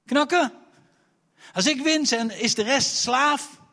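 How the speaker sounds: high, loud mocking challenge; Goliath shouting his duel terms at Israel; giant's taunt. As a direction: bellow the deal at them.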